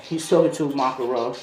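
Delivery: usual speaking voice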